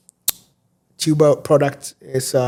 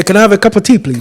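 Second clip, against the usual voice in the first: playful mock British accent, higher and louder; hammed-up British accent ordering tea; 6 semitones higher, 7 dB louder, faster than usual. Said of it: "the British accent is fun".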